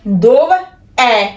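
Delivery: ਦੋਵ, a short break, then ਐ; split "dov - è". Shown as a demonstration. Teacher explains the written apostrophe, ਦੋਵ and ਐ said as two parts. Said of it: "ਇਹਨੂੰ ਕਹਿਣਾ ਦੋਵ".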